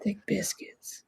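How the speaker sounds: breathy joking whisper of the words. Pretend. Cohost whispers a food joke, in a whisper. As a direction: whisper it like a tasty secret.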